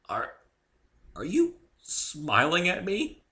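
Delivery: high, slow hesitant fearful ask; croaked question. A narrator's reading. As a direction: squeeze it out through fear. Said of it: "croaked out".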